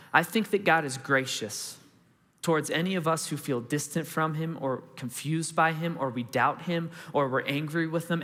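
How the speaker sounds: usual speaking voice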